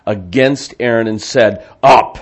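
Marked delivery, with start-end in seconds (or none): 1.8-2.2 loud, abrupt command; demanding voice. Acted. The people confronting Aaron, loud demand. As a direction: order it loudly, in one burst. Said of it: "that's why I use that voice".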